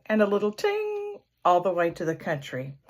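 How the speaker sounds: plain reading voice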